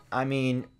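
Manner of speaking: ordinary talk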